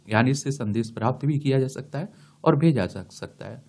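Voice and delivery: usual speaking voice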